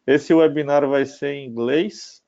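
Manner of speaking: usual speaking voice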